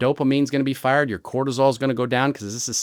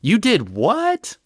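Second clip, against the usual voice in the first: high, drawn-out, incredulous outburst; judgmental shock; 9 semitones higher, 4 dB louder, much slower than usual. Acted. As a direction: act scandalized, voice shooting up at the end. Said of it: "a priest would never be like".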